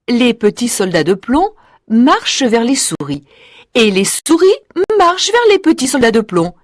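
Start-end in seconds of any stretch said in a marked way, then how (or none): none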